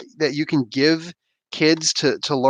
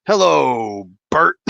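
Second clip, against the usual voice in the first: in-your-face drill sergeant; 3 dB louder, much slower than usual. Retold or imitated. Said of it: "get in your face".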